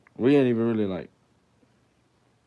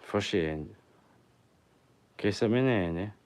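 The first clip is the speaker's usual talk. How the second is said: mock Italian; 5 dB louder than usual. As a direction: fake Italian gibberish, louder. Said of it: "Are you a, like, a New York Italian?".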